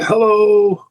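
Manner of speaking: high, drawn-out mock greeting; talking bone. Officer voicing an exposed ankle bone, comic high greeting. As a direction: sing out a comic high-pitched hello. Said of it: "his bone on his ankle is just saying".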